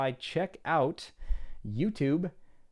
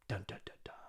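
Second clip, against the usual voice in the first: whispered dramatic dun dun dun; ominous whispered sting; 3 semitones lower, 13 dB quieter, faster than usual. Pretend. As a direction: hush it like a suspense sting.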